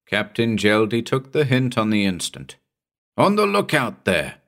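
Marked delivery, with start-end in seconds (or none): 3.2-4.4 higher call up the deck; shouted. A narrator's reading. Captain hailing the lookout through fog, shout. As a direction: hail the lookout sharply. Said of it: "he shouted".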